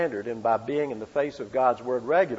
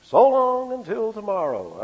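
a hint of an old radio sign-off, lifted higher; Lowell Thomas sign-off; 7 semitones higher than usual. Preacher briefly imitating a radio sign-off. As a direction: sign off like a genial newsman. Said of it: "Lowell Thomas".

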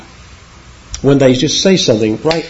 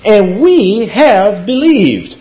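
"we" higher and held out, very slow; emphatic we; 7 semitones higher, much slower than usual. Shown as a demonstration. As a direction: lean hard on "we". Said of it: "There's an emphatic "we" there".

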